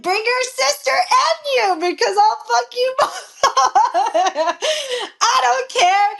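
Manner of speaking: high, slow retold taunt breaking into laughter; defiant comeback. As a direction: throw the dare back, then crack up.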